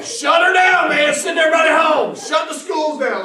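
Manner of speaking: high, loud shouted orders; hammed-up emergency yelling; mock panicked shouting. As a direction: holler the alarm across town. Redneck panic, ordering town shutdown.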